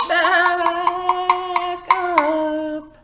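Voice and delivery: high, loud silly complaint; silly passenger voice. Acted. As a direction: squeak it out like cross passengers. Passengers' line in kids' song.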